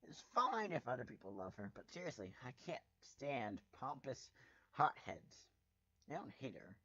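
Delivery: plain reading voice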